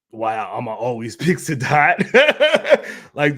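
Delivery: chuckling through the words; laughing. Real, not acted. Streamer picking his friend, laughing. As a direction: laugh it out, slow.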